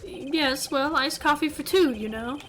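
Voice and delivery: breezy mock-English bellboy; horrible English accent. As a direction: justify it in a hammed-up English accent. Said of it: "saying it in this horrible English accent".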